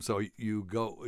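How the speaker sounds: usual speaking voice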